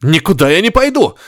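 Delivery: high, defiant shout; stubborn reporter refusing the order, shouting; yelling. A narrator's reading. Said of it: "заорал Горишвили".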